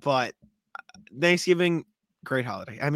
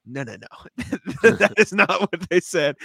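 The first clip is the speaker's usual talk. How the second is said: laughing correction; 3 semitones higher, 3 dB louder, slower than usual. Retold or imitated. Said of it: "And I just go".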